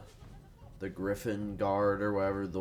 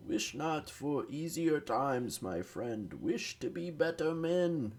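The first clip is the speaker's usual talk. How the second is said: slow, higher, grand heroic proclamation; mock-epic high heroic quote; quoting a game hero; 8 semitones higher, slower than usual. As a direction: intone it like a noble game hero giving a rallying line.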